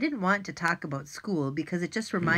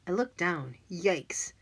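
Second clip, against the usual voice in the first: ordinary delivery, nothing marked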